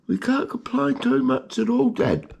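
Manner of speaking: squeaky high little-rat voice, cheerful; pet rat's voice. Pretend. Pet rat Greggy answering his owner, squeaky and high. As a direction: answer Dad as a tiny proud rat.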